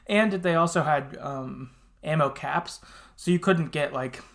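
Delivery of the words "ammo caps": ordinary delivery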